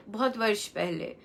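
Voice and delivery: ordinary delivery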